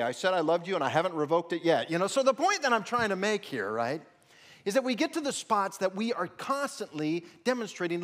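loud, rising pulpit delivery; fiery sermon voice; preaching at full force. Acted. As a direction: press every line on the congregation. Preaching story and points loudly.

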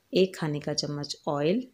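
ordinary talk

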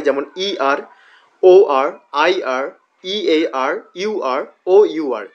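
ordinary delivery

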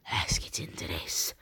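low whisper, hushed. Pretend. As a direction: whisper it like a secret. Sudden whisper between packs.

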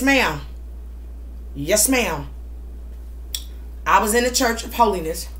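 usual speaking voice

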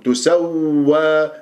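تسوى slow with the doubled waw sustained; both waws heard. Shown as a demonstration. Teacher models for students, continuous doubled waw in تسوى. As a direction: hold both w sounds in تسوى. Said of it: "ولازم أسمع صوت الواوات اثنيناتهم".

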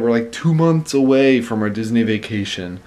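ordinary talk, nothing marked

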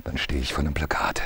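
hushed, hurried whisper. Acted. Haunted man whispering what he sees. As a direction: breathe the memory out.

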